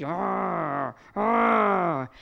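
a beast-like roar rising in force; growling roar. Retold or imitated. Possessed girl roaring at the speaker, hammed-up growl. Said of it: "and she's".

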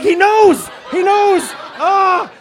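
high, loud panicked shriek; hammed-up freak-out at being found out; comic panic. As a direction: scream it in mock terror. Panicked thought after doorman guesses.

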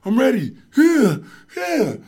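high, loud yeah yeah; pumped up. Retold or imitated. Psyching up for sumo with the elephant, hyped. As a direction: hype yourself up. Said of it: "So I was like".

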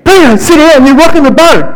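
the crew losing patience, pitch climbing; exasperated scold. Retold or imitated.

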